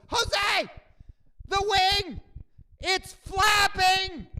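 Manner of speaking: high, loud, slow panicked announcement; way too loud. Retold or imitated. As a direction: blurt it out so the whole plane hears. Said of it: "in way too loud a voice".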